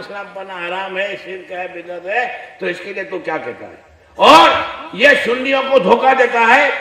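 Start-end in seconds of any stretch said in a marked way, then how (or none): 4.2-6.7 loud, high challenge to the rival sect; fiery preaching. Acted. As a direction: thunder it out from the pulpit, daring them to debate. Preaching at full force. (ऊँची आवाज़ में जोशीला was)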